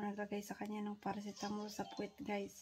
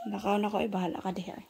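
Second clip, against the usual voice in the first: normal talking voice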